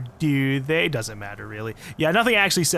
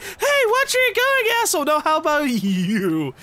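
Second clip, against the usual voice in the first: high, mock yelled road-rage lady; mocking the angry pedestrian; 10 semitones higher than usual. Streamer mimics the woman yelling at the driver, high mock shout. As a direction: parody her snapping at the driver. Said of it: "She fucking walks into the street like".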